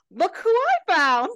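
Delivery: high, loud gasp and announcement; theatrical high-pitched reveal; mock grand entrance. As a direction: burst in with hammed-up excitement. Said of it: "George busts through the doors".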